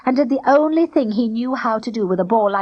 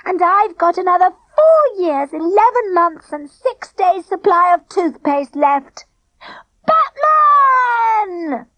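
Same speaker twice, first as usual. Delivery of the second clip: gleeful boast; 10 semitones higher, 4 dB louder, slower than usual. A narrator's reading. Denzel gloating about toothpaste.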